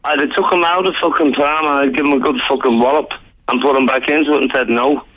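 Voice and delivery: ordinary delivery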